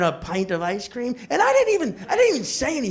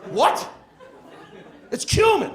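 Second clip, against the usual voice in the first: high-pitched indignant outburst; mock-angry correction, pitched way up; exasperated roommate; 5 semitones higher than usual. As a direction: jump up high in outraged disbelief. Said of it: "And he goes".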